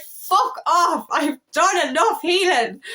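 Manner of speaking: angry outburst. Retold or imitated. Fed up with healing, snapping at the twin in anger. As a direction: throw it back at your twin, fed up. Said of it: "you get angry and you get frustrated".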